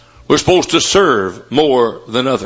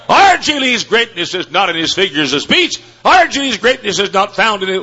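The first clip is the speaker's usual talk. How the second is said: preaching at full force; 10 semitones higher than usual. Acted. Preacher hailing R G Lee's second mile, shouting high. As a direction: ring it out from the pulpit. Climactic sermon story, shouting.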